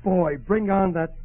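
loud, high, relishing cry; eager delight. Acted. Hungry husband calls for the roast, excited. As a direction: savour it loudly, drawing out the words.

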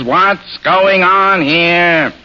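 flat, nasal deadpan; Ned Sparks. Shown as a demonstration. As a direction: drone it evenly through the nose. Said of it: "speaking in the flat nasal voice of Ned Sparks".

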